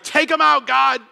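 fed up, telling God to finish them; angry Moses. Acted. Moses pleading with God against the rebels, angry demand. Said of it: "Moses angry with the people".

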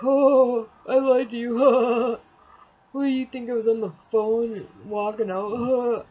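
loud, jeering imitation; mocking imitation. Imitating him admitting he lied, loud and mocking. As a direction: blare it out as a taunt.

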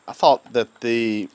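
ordinary talk

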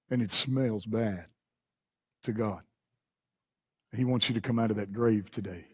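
low, hushed and weighty; grave appeal. Real, not acted. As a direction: sink the voice right down, with long pauses. Preacher drops voice for appeal.

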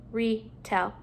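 "retell" split after the prefix; parts apart. Shown as a demonstration. Teacher putting a prefix at the beginning, parts apart. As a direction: pause between "re" and "tell". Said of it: "putting a prefix at the beginning".

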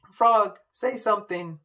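high, loud panicked plea; frightened call. Acted. Poppy horrified calling.

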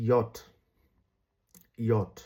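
ইয়ট said alone as "yot", with a y glide; name the J as ইয়ট, starting on a y sound; German letter name. Shown as a demonstration. Teacher naming German J for learners, German letter name. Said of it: "J কে ওরা বলে হচ্ছে".

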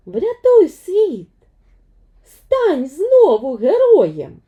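put-on girl calling her hero to act; Vaiana's rallying cry. Vaiana urges Maui to save the world, loud and slow.

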